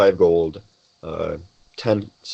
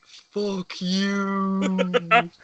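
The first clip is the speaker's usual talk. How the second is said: high, drawn-out imitation of yelling children; mock crowd yell; 13 semitones higher than usual. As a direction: stretch it out high, like distant kids jeering. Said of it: "just yell".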